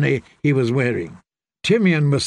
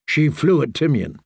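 ordinary delivery

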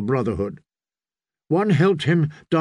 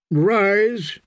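commanding priest; 7 semitones higher than usual. Acted. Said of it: "he told the sputtering boy".

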